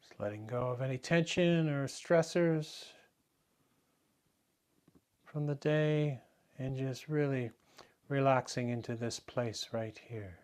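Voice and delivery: soft, unhurried meditation guidance with long pauses; guided meditation. Real, not acted. Teacher guiding the class to relax into the breath, soft meditation voice. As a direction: settle them quietly into the breath, softly.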